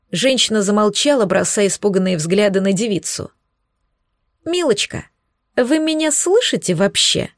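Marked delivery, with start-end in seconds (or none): 4.5-7.3 high, patronising countess; condescending countess. A narrator's reading. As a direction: talk down to her from on high. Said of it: "Не унималась эта тупица".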